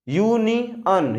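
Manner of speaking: यूनियन drawn out slowly, with the final on as a short "an"; यूनियन said "yoo-ni-yan", not "yoo-ni-yon"; pronouncing union. Shown as a demonstration. Teacher spells out यूनियन slowly. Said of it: "ओ के बाद क्या आ रहा है?".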